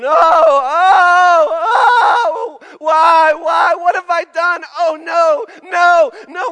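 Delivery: high, loud hammed-up wail, half laughing; a loud, high, laughing parody of a moan; mock wailing mother-in-law. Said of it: "continues to scream and moan".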